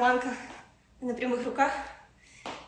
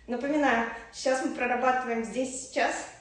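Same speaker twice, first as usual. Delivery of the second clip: usual speaking voice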